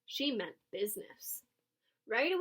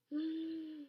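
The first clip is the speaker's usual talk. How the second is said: sharp soft gasp; surprised gasp; 6 semitones higher, 5 dB quieter than usual. A narrator's reading. Reveal of the dress.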